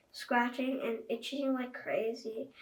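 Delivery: ordinary delivery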